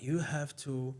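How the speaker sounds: soft, hushed odd voice; quietly imitating a strange delivery; weird tone. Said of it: "He speaks in, like, a weird tone".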